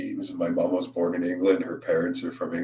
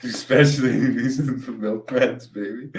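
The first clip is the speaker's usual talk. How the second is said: laughing through the words, higher; 5 semitones higher, 4 dB louder than usual. Real, not acted. Streamer laughing at his own milkman joke. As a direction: crack up at the punchline.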